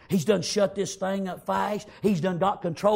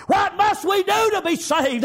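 high, loud shout of panic; desperate cry; 8 dB louder than usual. Retold or imitated. Frightened family crying out to be saved, loud and high.

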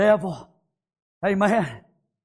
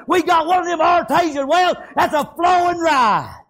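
high, driving revival preacher; high-pitched pulpit shouting; full-force preaching; 8 semitones higher, 3 dB louder than usual. Real, not acted. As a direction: preach it hard, pitched well up.

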